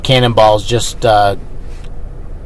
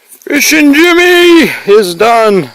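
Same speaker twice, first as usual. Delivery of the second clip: announcing; 13 semitones higher, 5 dB louder, slower than usual. Real, not acted. Reading trail sign aloud.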